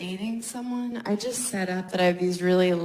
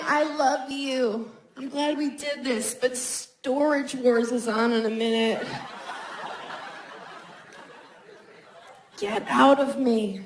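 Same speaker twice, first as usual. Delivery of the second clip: mock sweet dismissal; 3 semitones higher than usual. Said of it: "We're saying".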